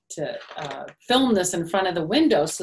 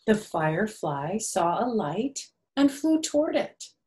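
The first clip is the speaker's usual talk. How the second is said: gentle, sing-song storybook reading; lightly lilting picture-book narration; reading to children; 2 semitones higher, 3 dB louder than usual. A narrator's reading. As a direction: read it like circle time for little ones.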